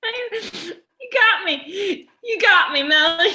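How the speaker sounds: high, touched exclamation; choked up. Real, not acted. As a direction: caught off guard by emotion. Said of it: "I got myself too".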